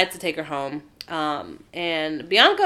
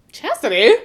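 high, loud questioning call; mock disbelief; 5 semitones higher, 8 dB louder than usual. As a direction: call the name in acted disbelief. Acting out Bianca's shocked protest.

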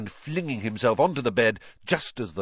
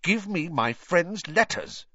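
higher-pitched, insistent demand; angry demand; 8 semitones higher than usual. A narrator's reading. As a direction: demand them back angrily. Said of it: "said Harry angrily".

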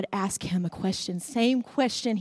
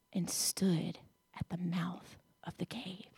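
whisper; 4 semitones lower, 13 dB quieter than usual. Acted.

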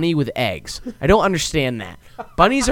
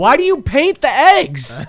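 high, slow disbelieving question; incredulous; 10 semitones higher, 7 dB louder than usual. Real, not acted. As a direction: ask it in amused disbelief. Incredulous ranting, laughing.

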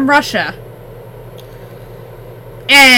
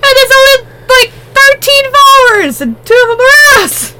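excited exclaiming; 11 semitones higher, 5 dB louder than usual. Real, not acted. Excited complaint about followers.

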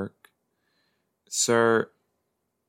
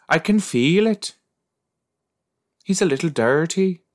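higher, louder mock Irish lilt; mock Irish accent; 10 semitones higher, 4 dB louder than usual. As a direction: imitate her Irish twist, pitched up. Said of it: "She got a little Irish on the end there".

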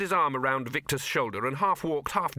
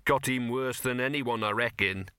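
ordinary delivery

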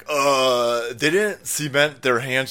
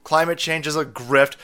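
normal talking voice

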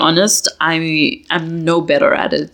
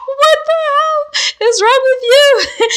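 much higher, slow, laughing through the words; laughing quote; 3 dB louder, slower than usual. Retold or imitated. Quoting her baffled partner, laughing hard. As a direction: squeal his line while cracking up. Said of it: "He was just like".

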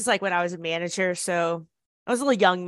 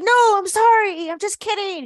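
calling out an apology; 12 semitones higher, 5 dB louder than usual. Retold or imitated. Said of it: "And I was like".

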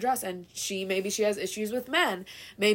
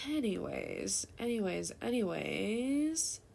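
drawn-out anyways; 8 dB quieter, slower than usual. Pretend. Drawn-out sing-song anyways.

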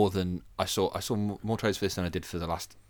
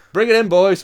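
loud, high hollered order; mock foreman's call; 10 semitones higher, 14 dB louder than usual. Nan ordering in forklifts of cakes, mock shouted command. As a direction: call out to the crew, pitched up.

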